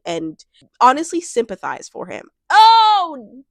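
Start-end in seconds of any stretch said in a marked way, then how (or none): 2.5-3.4 surprised. Real, not acted. Realizes she sympathizes with Nick.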